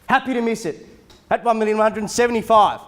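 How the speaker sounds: auctioneer calling bids. Acted. Live auction bidding calls.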